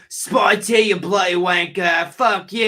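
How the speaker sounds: high, loud Cockney-style British shout; thick British accent. Acted. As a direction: bellow it slowly in a crude British accent. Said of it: "that thick British, like, accent".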